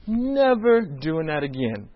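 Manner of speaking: slow, drawn-out exhausted vow; weary vow. Retold or imitated. Team after the challenge, slow and worn out. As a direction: drag it out like you're spent. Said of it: "and we just like".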